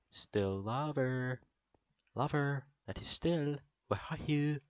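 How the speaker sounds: high, sing-out searching call; playful calling out. Pretend. Player searching for still lava, playful high call. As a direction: call for it as if it could answer, pitched high.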